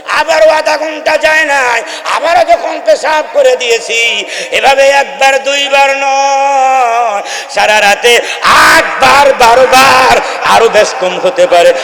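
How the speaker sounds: high, loud building sermon cry; fervent preaching. Acted.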